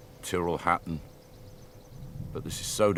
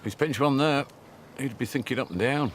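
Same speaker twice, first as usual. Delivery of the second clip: excited; 7 semitones higher than usual. Real, not acted. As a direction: lift the voice in amazement. Reacting to chip-in birdie.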